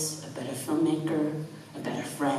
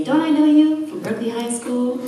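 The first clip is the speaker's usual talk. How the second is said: surprised greeting; 9 semitones higher, 6 dB louder than usual. Retold or imitated. Classmate greeting her, retold.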